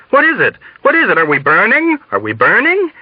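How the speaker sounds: high, frantic questions; panicked crying out. A narrator's reading. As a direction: cry out in rising panic. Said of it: "panic, crying".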